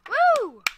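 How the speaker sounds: high, loud cheering "woo"; excited whoop. Real, not acted. Player celebrating a good move, loud high whoop. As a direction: whoop it out at full voice. Wins the game.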